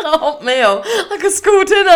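laughing through the words; words dissolving into laughter. Real, not acted. Reacting to a friend's slip about space, laughing.

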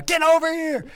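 high, loud hurry-up call; urgent yell. Retold or imitated. Coworker on the roof calling over in alarm. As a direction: shout for help from the roof. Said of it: "cussing in Spanish and screaming".

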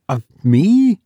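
high, sharply rising query; astonished, pitch leaping up at the end; surprised question. A narrator's reading. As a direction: ask it in startled disbelief. Hagedorn learns she spoke of him.

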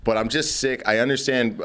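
normal talking voice

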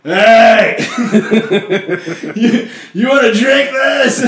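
put-on high, loud, slow drunken offer with laughter; drunk Nemeth. Drunk Nemeth offering rum mid-meeting, sloppy. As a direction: slur it like a hammered friend. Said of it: "Nemeth is literally like".

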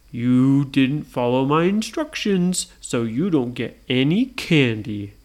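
ponderous, firm monster lecture; stern Frankenstein. Acted. Frankenstein refuses the brothers.

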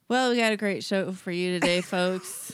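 ordinary talk, nothing marked

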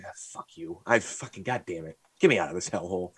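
low-volume, fed-up grumbling; muttered cursing. Retold or imitated. Fed-up customer to himself leaving Target, muttering. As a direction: curse under your breath. Retelling his silent reaction to clerk.

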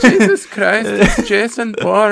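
high, slow, laughing mock Swiss accent. Host mocking the spy name in a fake Swiss accent. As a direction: ham up a silly Swiss accent. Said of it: "Swiss, eh?".

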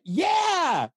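high, eager "yeah" called out; imitating his own excited yell of recognition; excited yes. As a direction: blurt it out, thrilled to be remembered. Thrilled to be recognized.

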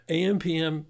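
normal talking voice

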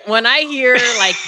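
ordinary talk, nothing marked

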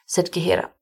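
plain reading voice

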